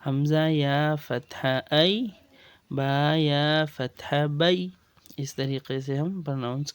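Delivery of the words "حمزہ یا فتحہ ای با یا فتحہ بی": slow, deliberate Arabic spelling, each syllable drawn out; slow spelling recitation. Shown as a demonstration. Teacher shows students how to pronounce ya leen, slow and deliberate. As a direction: sound out ای and بی carefully and slowly. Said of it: "ایسے یاء لین کا pronounce".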